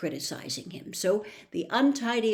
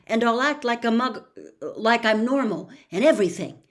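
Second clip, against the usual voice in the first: rising, louder, pushing the deal hard; pleading Harry; 4 semitones higher, 6 dB louder than usual. Acted. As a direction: talk him into it, fast and eager.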